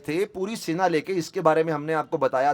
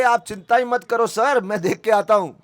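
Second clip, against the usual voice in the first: eager reassurance; 5 semitones higher, 5 dB louder than usual. Retold or imitated. Hanuman eagerly reassures Ram.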